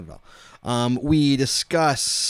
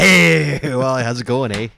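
mock Canadian accent, louder, leaning on the eh; a hammed-up Canadian greeting; bad Canadian accent; 8 dB louder, much slower than usual. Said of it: "you still can't do the accent".

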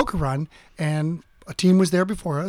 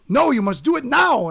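put-on insistent German-accented order, pitched up; 4 semitones higher than usual. Security guard rejecting the promise, imitated German accent. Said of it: "He was very German".

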